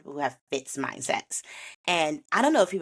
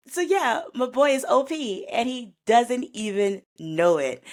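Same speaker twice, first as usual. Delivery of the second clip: gushing; 7 semitones higher than usual. Real, not acted. Narrator gushing about the hero.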